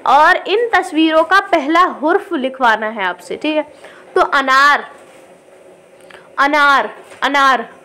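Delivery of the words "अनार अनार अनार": अनार with a short अ, said again and again; short a demo. Shown as a demonstration. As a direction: repeat अनार lightly on the अ. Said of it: "आवाज़ कम आ रही है". (अनार दोहराते हुए छोटा अ)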